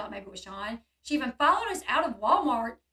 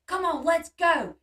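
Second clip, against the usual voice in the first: retold hurried call, louder and higher; urgent call; 5 semitones higher, 7 dB louder, slower than usual. Sean jumping in the car to his partner, urgent call. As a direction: urge them to move, drawn out and loud.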